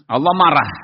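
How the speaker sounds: "marah" punched out loud and falling; stress on marah. Shown as a demonstration. Preacher teaching his students, hitting "marah" loudly. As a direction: lean hard on "marah".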